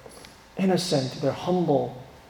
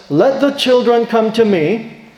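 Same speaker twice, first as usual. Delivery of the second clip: preached quote; 5 semitones higher, 6 dB louder, slower than usual. Acted. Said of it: "he says".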